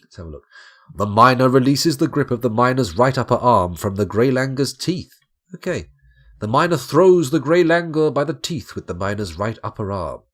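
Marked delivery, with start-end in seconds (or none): 0.9-5.1 loud, raised-pitch fight announcer; dramatic combat-log reading. Acted. 6.4-10.2 loud, raised-pitch fight announcer; dramatic combat-log reading. Acted.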